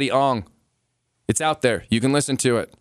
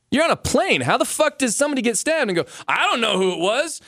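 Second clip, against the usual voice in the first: high, exasperated disbelief; can't believe it, voice jumps up; heated, high-pitched ranting; incredulous outburst; 10 semitones higher than usual. Real, not acted. Incredulous rant about calm call.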